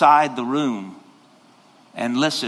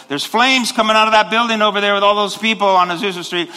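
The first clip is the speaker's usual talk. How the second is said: alarmed report; 6 semitones higher, 5 dB louder than usual. Retold or imitated. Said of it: "contact the fire department and say".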